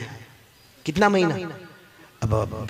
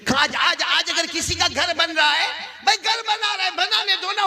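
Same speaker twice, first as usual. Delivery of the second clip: high, agitated sermon tirade; impassioned preaching; 11 semitones higher than usual. Acted. Preacher mocking envy of a neighbour's new house, agitated. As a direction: harp on each item at high pitch.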